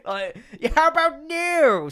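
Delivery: put-on disbelieving shriek at the kid; shocked outburst. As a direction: parent catching son sneaking the cake upstairs, shocked. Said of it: "I'm like".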